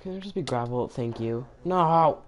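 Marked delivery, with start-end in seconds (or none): 1.6-2.2 loud frustrated yell; shout. Real, not acted. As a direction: yell it out in dismay. Gravel falls on player.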